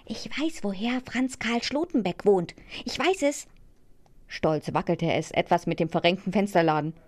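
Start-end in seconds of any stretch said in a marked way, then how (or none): 0.0-3.5 high, whispered eager call; soft call from above. A narrator's reading. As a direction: whisper it down, higher and softer. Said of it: "rief leise hinab".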